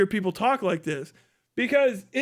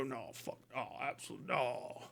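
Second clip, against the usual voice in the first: barely audible, low hushed no; hushed mock rejection; whispered refusal; 10 semitones lower, 18 dB quieter than usual. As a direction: mutter the refusal under breath.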